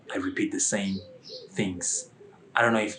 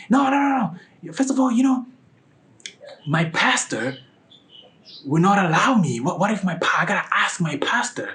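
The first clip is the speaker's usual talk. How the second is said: high, protesting imitation; flustered refusal; 9 semitones higher, 4 dB louder than usual. Woman saying the pastor won't allow it, flustered refusal. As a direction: imitate someone hastily backing out, pitched up.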